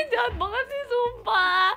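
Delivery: high, loud laugh running into speech; real giggly indignation, pitched high; laughing protest. Real, not acted. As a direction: protest through a burst of laughter. Laughing again at the teasing.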